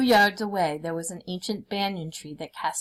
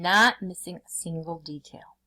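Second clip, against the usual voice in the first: ordinary delivery, nothing marked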